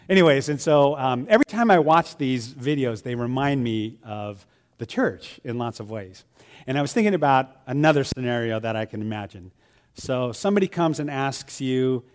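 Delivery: ordinary talk, nothing marked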